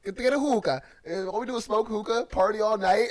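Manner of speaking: put-on loud, high-pitched Dominican party-guy accent; Dominican impression. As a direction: hype it up in a mock Dominican accent. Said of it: "you're definitely Dominican".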